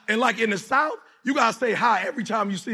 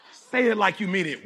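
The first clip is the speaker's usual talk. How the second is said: usual speaking voice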